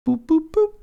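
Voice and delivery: three tiny high boops; boop noises. Pretend. Gamer placing three torches, high sing-song boops. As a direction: pop out little high-pitched blips. Placing items while crafting.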